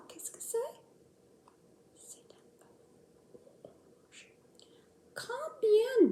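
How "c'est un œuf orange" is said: whispering. Acted. Whispered answer.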